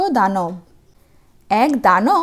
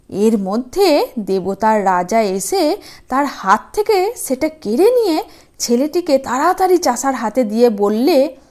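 plain reading voice

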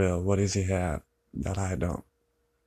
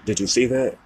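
suddenly louder and higher, pressing her; raised plea; 5 semitones higher, 5 dB louder than usual. Real, not acted. To the girl who left, a sharp raised plea. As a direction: push the question hard at her.

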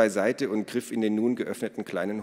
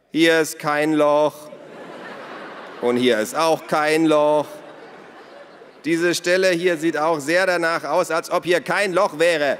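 high, loud calls across a distance; calling out; 8 semitones higher, 8 dB louder than usual. A narrator's reading. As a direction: shout your findings outside, stretching the short ones. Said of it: "Dann rief er". (laut und hoch herübergerufen)

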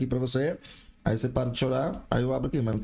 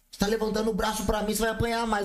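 higher mock scolding mother; imitating angry mother; 9 semitones higher than usual. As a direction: scold like a furious mom, hammed-up. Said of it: "Sabe o que ela falou?".

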